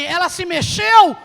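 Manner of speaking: loud, slow shouted question; loud anxious question. Retold or imitated. Worried man asking the neighbour if she moved, loud anxious shout. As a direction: call out urgently for news.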